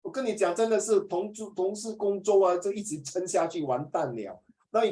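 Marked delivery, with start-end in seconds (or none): none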